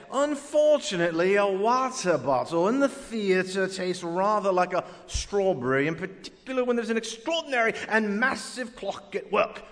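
hammed-up posh British accent; mock British documentary voice. Shown as a demonstration. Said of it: "say it with a British accent".

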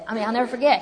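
usual speaking voice

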